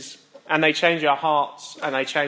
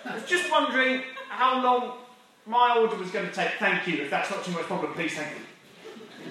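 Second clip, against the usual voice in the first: timid, overly polite complaint, softer and higher; passive-aggressive English; 5 semitones higher, 4 dB quieter than usual. Retold or imitated. Customer asking politely after waiting too long, passive-aggressive. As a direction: swallow your rage and ask oh so politely. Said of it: "in your most passive-aggressive English way you say".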